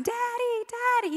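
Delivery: put-on very high small-child cry; imitating little kid. Stranger's child running to father, high kid voice. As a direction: squeak it like a toddler running up.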